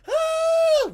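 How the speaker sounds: put-on high, loud scream of fleeing kids; piercing mock shriek. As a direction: scream like kids running away. Kids running away screaming.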